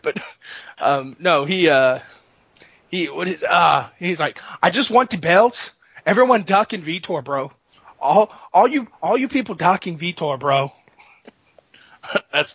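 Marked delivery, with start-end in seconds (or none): 4.6-10.7 mock accented fighter, slightly raised pitch; Vitor impression. Fighter complains that everyone avoids him, mock accent. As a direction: grumble as a ducked fighter in accent. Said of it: "that's Vitor".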